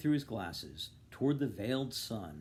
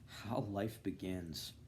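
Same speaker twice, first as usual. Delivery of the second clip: ordinary delivery, nothing marked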